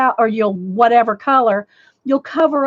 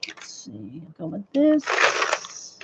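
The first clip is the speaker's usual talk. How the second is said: soft slow near-whisper; slow, soft ASMR murmur; ASMR moment; 6 semitones lower, 8 dB quieter, slower than usual. Real, not acted. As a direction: murmur it close to the mic. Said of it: "A S M R moment".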